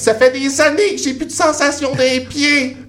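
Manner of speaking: mother explaining she has no feeling in her feet; mother's reply. Retold or imitated. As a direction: voice the mother, high and matter-of-fact. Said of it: "Elle dit".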